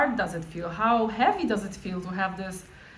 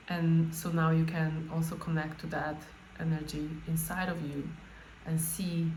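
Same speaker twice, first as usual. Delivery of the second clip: guided meditation; 2 semitones lower, 4 dB quieter than usual. Acted. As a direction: gently guide them into the body.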